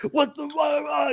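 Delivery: very high, slow stretched what the fuck; shocked squeal. Retold or imitated. Kid Cash squeals in pained shock, high and drawn out. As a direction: squeal it out in disbelief. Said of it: "he's like".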